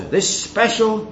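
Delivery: ordinary talk, nothing marked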